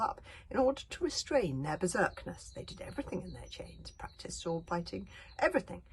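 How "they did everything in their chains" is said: ordinary delivery, nothing marked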